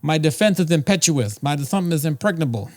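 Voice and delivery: high, lisping Tyson voice; Mike Tyson impression. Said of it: "What does Tyson say?".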